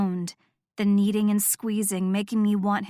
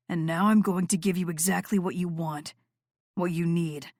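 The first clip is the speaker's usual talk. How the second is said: low seductive voice; 3 semitones lower than usual. Acted. Noah voiced lower.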